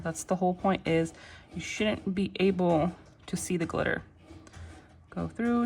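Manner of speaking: normal talking voice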